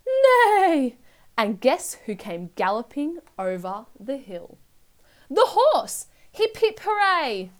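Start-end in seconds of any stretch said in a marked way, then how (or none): 0.1-1.0 high, loud, drawn-out whinny on NEIGH; baby's neigh. A narrator's reading. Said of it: "Then she started to neigh". 5.3-7.5 high, jubilant storyteller's cheer; excited cheer. A narrator's reading. Narrator cheers the horse's arrival.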